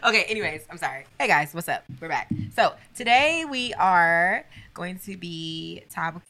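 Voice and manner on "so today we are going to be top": slow announcer voice. Pretend. Mock grand intro.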